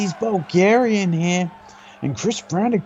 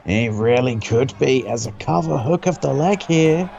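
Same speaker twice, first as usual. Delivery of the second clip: wrestling commentator; 2 semitones higher than usual. Acted. Live wrestling play-by-play.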